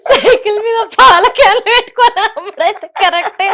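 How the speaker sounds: talking through laughter; real laughter at the Malla Reddy line; laughing. Real, not acted. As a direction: speak while cracking up. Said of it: "నవ్వి నవ్వి కడుపు ఉబ్బేటట్టుంది".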